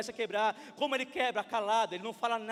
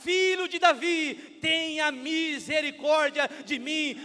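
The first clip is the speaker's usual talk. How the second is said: loud, slow retold cry; drawn-out shouted plea, imitating the blind man; shouting louder; 7 dB louder, slower than usual. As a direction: cry out for mercy at full volume. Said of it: "mais ele gritava".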